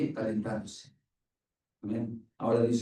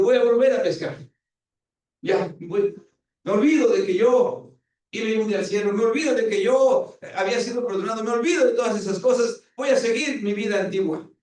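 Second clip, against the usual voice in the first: raised, higher voice of a man giving up, turning back to fishing; defiant Peter; 8 semitones higher, 6 dB louder than usual. Retold or imitated. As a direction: throw it all away and say it loud.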